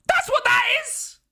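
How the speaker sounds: high shout rising at the end; startled shout. Real, not acted. Streamer shouts at his cat in alarm. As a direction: yell it out in alarm.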